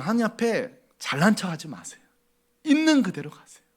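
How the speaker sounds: ordinary delivery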